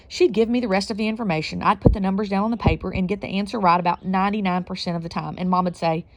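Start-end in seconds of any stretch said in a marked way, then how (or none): none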